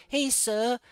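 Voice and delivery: mock stiff polite voice. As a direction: play a stiff, prissy Phil. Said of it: "He's like".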